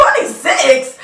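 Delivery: loud, high disbelieving twenty-six; stunned repeat of the age; shocked echo. Retold or imitated. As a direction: blurt it back in disbelief. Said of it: "I said".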